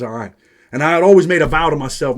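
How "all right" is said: low, soft, veiled threat on all right; a cellmate muttering a half-hearted threat, lower and softer; half-ass threat. Retold or imitated. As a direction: drop it low and quiet like a warning. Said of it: "he half-ass threatens me when he says".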